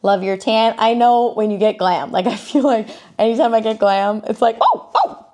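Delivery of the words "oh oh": high-pitched startled "oh, oh"; mock amazement. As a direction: gasp it in playful astonishment. Acting amazement at own glam.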